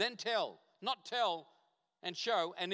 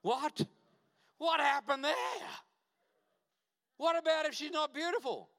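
shocked; 8 semitones higher than usual. Real, not acted. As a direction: throw it back in stunned outrage. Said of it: "I was sort of shocked".

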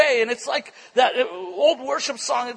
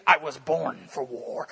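low, slow imitated warrior vow; mock solemn warrior; 8 semitones lower, slower than usual. Warrior refuses preacher's class, mock solemn. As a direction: drop low and draw it out like a self-serious warrior.

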